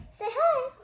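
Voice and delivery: high, sweet prompting; high cutesy voice. Pretend. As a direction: pipe it up like to a toddler.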